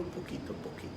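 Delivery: "poquito a poquito" breathed as a bare whisper. Shown as a demonstration. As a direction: drop to a secret whisper on "poquito a poquito". Repite en susurro. (susurro: "poquito a poquito" dicho sin voz)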